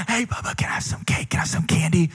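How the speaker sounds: secret whispered begging. Retold or imitated. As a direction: sneak the ask in a hush. Kid asking brother secretly.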